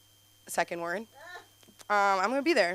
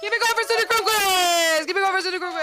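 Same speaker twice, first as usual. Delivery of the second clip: high, loud hype the crowd; announcer call; 10 semitones higher, 6 dB louder than usual. Real, not acted. Announcing next act.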